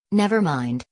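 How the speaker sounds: ordinary delivery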